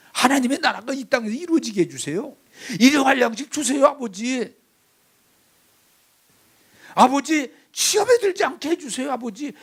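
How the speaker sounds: high-pitched, insistent calling on father; bold demanding prayer. Shown as a demonstration. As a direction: demand it like a confident child. Models prayer as a child's demand.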